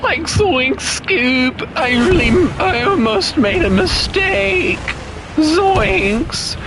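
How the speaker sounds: high goofy cartoon voice, slow; hammed-up Shaggy impression. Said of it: "comment about Scooby-Doo and all".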